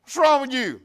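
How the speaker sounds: loud, urgent mock command; retold shouted order. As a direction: call the panicked child back sharply. Startled grandfather orders screaming child back.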